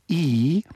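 E said with the tongue pushed forward and high; small front-mouth E; bright E vowel. Shown as a demonstration. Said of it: "I've actually made the mouth resonator very small".

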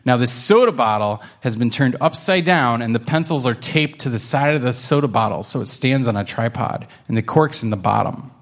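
plain reading voice

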